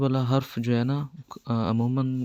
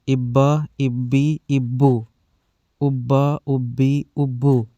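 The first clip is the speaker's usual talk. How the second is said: louder fluent word drill; loud, paced classroom recital of words; drill reading; 6 dB louder, much slower than usual. Shown as a demonstration. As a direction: call out each word clearly for kids to repeat. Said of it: "مہربانی کے ساتھ پڑھ رہا ہوں".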